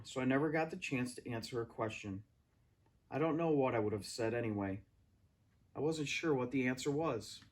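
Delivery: plain reading voice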